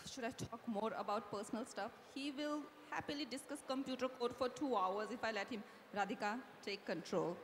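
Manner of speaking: low, soft thinking-aloud narration; sigh, then reason quietly with yourself; inner monologue. A narrator's reading. Radhika weighing what to ask, low inner monologue.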